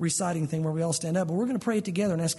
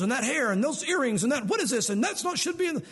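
higher, building nagging of a worried parent; fussing parent; 4 semitones higher than usual. Acted. Parent fretting over a kid's clothes, mock fussing. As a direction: pile up complaints like a flustered parent.

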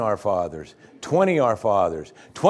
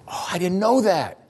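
mock surprised God; 8 semitones higher than usual. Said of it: "It's just like".

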